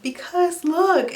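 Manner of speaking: high, slow reveal; eager. Retold or imitated. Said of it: "And I'm like".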